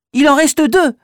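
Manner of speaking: put-on child voice. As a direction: answer quick and proud. Child answers riddle.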